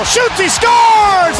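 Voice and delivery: drawn-out high shouted goal call. Real, not acted. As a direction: belt out the goal slowly.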